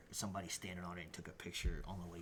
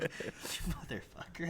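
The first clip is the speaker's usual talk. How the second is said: laughing mock curse; 10 semitones higher, slower than usual. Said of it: "I was like".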